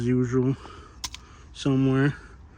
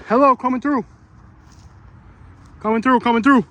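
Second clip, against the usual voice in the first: high, loud announcing call; coming through shout; 7 semitones higher, 4 dB louder than usual. Real, not acted. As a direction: call out so nobody gets startled. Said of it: "always good to announce yourself".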